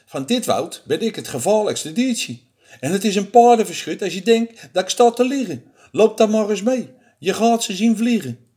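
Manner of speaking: a hint of a cocky little mouse; lightly voiced boastful mouse. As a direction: bluff confidently as the small mouse.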